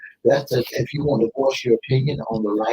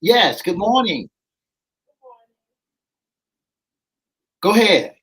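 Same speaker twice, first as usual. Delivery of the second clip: raised, higher voice to a faint caller; calling out; 6 semitones higher than usual. Real, not acted. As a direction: prompt a caller who can't hear. Host prompts silent caller.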